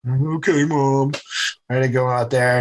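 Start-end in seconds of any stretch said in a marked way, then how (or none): 0.1-1.1 mock defeated child's reply; dejected kid. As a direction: imitate a sulking, defeated boy. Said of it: "I'm having a full pity party".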